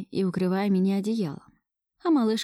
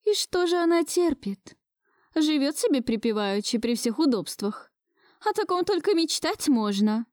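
put-on higher-pitched, unyielding old woman; stubborn grandmother; 8 semitones higher than usual. A narrator's reading. Grandmother refusing to back down about the girlfriend, stubbornly. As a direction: scoff insistently in the grandmother's raised pitch. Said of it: "не сдавалась упрямая бабка".

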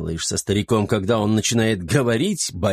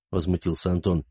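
plain reading voice

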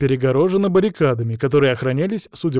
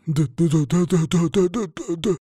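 higher, stammered string of syllables; backing away nervously; 5 semitones higher than usual. Acted. Patrol leader retreating from the dragon, nervous stammer. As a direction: rattle it out, fading away. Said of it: "попятился лидер патруля".

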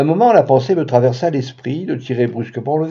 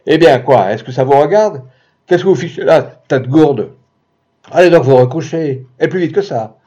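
angry yelling; 6 dB louder than usual. Acted. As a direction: chase the neighbours off. Husband yells at neighbours. (voisins chassés en criant, plus fort)

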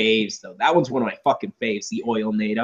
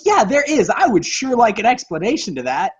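sarcastic reply; 7 semitones higher than usual. Retold or imitated. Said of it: "And I'm like".

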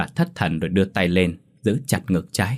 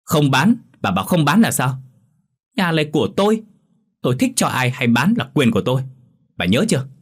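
angry shout; 6 semitones higher, 3 dB louder than usual. A narrator's reading. Son shouts at pleading mother.

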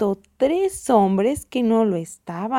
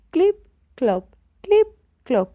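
high, punched clip, dropping clop; donkey hoofbeats; 7 semitones higher, 5 dB louder than usual. A narrator's reading. The parade goes on, imitated hoofbeats. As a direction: tap out loud hoof steps with the voice. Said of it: "Se oyen los pasos del burrito".

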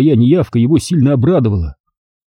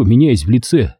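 ordinary delivery, nothing marked

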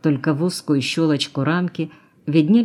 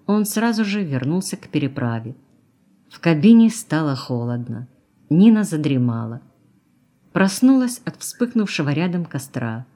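plain reading voice

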